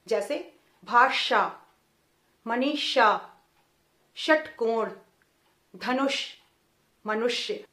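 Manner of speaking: भाषा said slowly with a heavy retroflex ष; मनीषा षटकोण with tongue curled back, heavy and grave; retroflex sh demo. Shown as a demonstration. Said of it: "गंभीरता और भारीपन के साथ उच्चारण". (भारीपन और दोगुने बल से ष बोलना)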